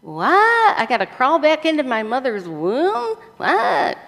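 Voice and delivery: high-pitched comic bafflement; utterly confused, hammed up; Nicodemus. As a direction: squeak out incredulous questions. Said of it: "Nicodemus says".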